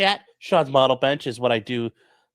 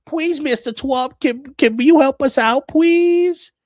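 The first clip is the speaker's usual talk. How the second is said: much higher, slow, whimpering plea; mock Carney pleading; 13 semitones higher, 6 dB louder, slower than usual. Mocking Carney begging Trump at the G7, high slow whimpering plea. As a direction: beg like a helpless little kid. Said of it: "Carney's like".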